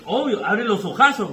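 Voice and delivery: loud, high "abre los ojazos"; excited exclaim. Shown as a demonstration. Teacher shows how you react to tasty food, loud and excited. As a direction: burst out wide-eyed with delight. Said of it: "dices así".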